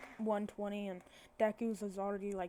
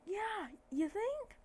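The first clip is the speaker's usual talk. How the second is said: high, slow, drawn-out retort; incredulous sarcasm; 11 semitones higher, much slower than usual. Retold or imitated. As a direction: stretch it out high, mock disbelief. Crowd's sarcastic reaction, acted.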